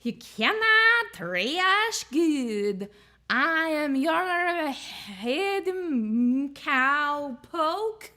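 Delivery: slow drawn-out southern goblin; southern accent. Acted. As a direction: stretch it out like a goblin cowpoke. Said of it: "Trash Goblin doing southern accent".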